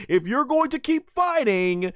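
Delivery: high mock Bulma voice; Bulma pleading. Bulma warning Vegeta, high mock voice. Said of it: "And she says".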